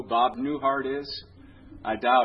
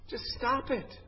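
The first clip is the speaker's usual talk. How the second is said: mock exasperation; 11 semitones higher, 4 dB quieter than usual. Preacher imagining saying it to people, mock exasperation. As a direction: plead it in a high, exasperated voice.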